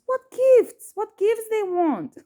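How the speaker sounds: high-pitched incredulous outburst, first burst loud; puzzled. Retold or imitated. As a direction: exclaim in utter bafflement, voice leaping way up. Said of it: "I was very puzzled".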